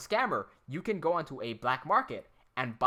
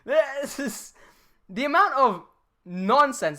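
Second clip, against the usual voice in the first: angry rant; 11 semitones higher, 8 dB louder, slower than usual. Real, not acted. Ranting at absurd scam call.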